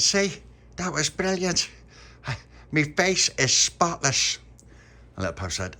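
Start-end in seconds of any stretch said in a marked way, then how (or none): 0.0-4.4 high, slow, loud boast; proud Tony. A narrator's reading. Tony boasts to the puppy about his spotless face, high and slow. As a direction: show off a clean face.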